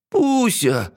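high wailing call; near tears. A narrator's reading. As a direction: wail for her pet. Princess wails to dragon.

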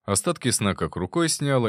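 usual speaking voice

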